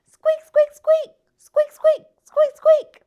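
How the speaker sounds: very high, loud excited hamster squeaks; very excited squeaking. A narrator's reading. Hamster racing to frog's tank, high loud excited squeaks. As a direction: squeal urgently like a thrilled hamster. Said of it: "he says in a very excited voice".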